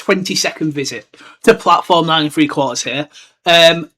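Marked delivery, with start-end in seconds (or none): none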